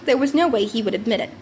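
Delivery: ordinary delivery, nothing marked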